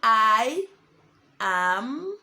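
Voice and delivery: drawn-out words with long gaps; dictating while writing. Real, not acted. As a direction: stretch each word as you write it. Dictating each word while writing it.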